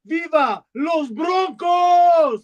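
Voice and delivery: lucha libre announcer. Acted. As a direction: stretch out "Viva los Broncos" like a ring announcer shouting to the arena. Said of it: "Will you say for us in Spanish".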